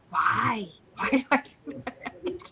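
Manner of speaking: slow, drawn-out mock wail; mock crying. Admitting she cries when angry, mock tearful. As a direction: imitate yourself helplessly crying, half laughing. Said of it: "I just start to cry".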